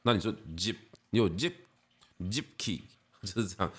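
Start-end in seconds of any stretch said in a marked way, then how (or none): 1.3-2.8 zip key very slow, zip shut on a clear p; p stop shown. Shown as a demonstration. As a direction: stretch zip key with a hard p close. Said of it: "你那个音就变成p".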